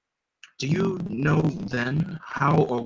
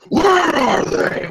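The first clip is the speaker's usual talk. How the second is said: high, slow strained yell; Batman yell impression; 8 dB louder, much slower than usual. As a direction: imitate a raspy hero yelling a question. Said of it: "moments where he had to yell".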